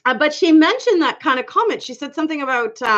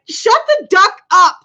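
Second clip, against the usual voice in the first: mock mad typing; 7 semitones higher, 6 dB louder than usual. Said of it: "when you're mad and you are really trying to type".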